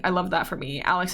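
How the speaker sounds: ordinary talk, nothing marked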